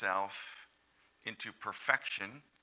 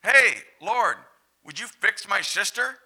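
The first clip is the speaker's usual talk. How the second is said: higher, louder demanding plea; fed-up Martha; 9 semitones higher, 10 dB louder than usual. Acted. Martha asking the Lord to fix her sister, exasperated. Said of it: "And then she's like".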